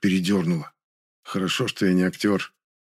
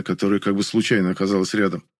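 ordinary delivery, nothing marked

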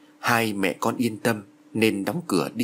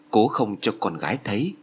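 ordinary delivery

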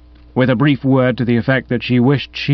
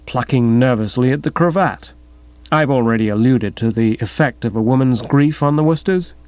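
usual speaking voice

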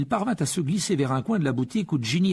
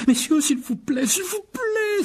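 high, climbing, almost wailing plea; pleading exclamation; slower than usual. A narrator's reading. Shop assistant begs two brawling men, frantically exclaiming. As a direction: beg the brawlers to stop. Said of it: "s'exclama un vendeur".

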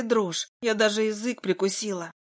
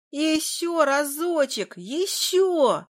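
put-on high, slow pleading kids' cry; demanding children; 7 semitones higher, much slower than usual. A narrator's reading. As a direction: beg like excited children. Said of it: "требовала детвора". (детский требовательный крик)

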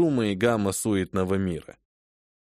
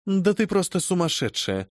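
cold, pitiless; 6 semitones higher than usual. Acted. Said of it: "холодным, безжалостным голосом".

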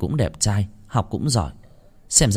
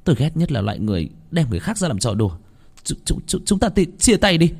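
hissed through teeth; 7 semitones higher than usual. A narrator's reading. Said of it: "Tôi rít qua kẽ răng".

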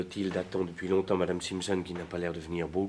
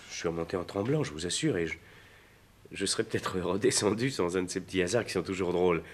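ordinary talk, nothing marked

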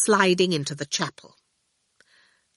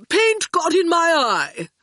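lofty and slow, higher voice; haughtily; 11 semitones higher, 5 dB louder than usual. A narrator's reading. Torquil denying the wink to Orphle, slow and haughty. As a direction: dismiss it from on high. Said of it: "Torquil said haughtily".

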